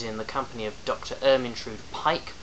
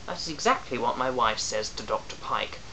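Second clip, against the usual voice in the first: plain reading voice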